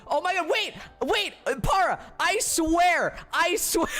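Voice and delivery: very high-pitched squealed disbelief; frantic protest. Real, not acted. Startled by coincidence in chat message.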